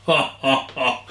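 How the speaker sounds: quick surprised laugh; short laugh. Real, not acted. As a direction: laugh at the sourness. Laughs after first sip.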